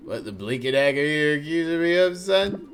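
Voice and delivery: put-on loud, high blustering old sailor; drunk sailor. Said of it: "act like a drunk sailor".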